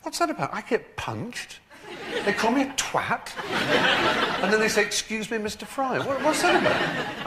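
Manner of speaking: high-pitched, baffled indignation; comically high, incredulous complaint; imitating Nicholas Lyndhurst. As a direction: protest in a squeaky, aggrieved mock voice. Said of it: "Nicholas was saying".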